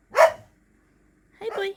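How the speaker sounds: high cooing voice. Real, not acted. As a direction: coo at the little pet. Cooing at the pet.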